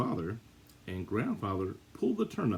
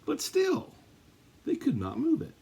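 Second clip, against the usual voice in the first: squeaky high disbelief on but still; dramatic high exclaim. A narrator's reading. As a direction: leap way up in mock astonishment. Suspense beat in read-aloud story.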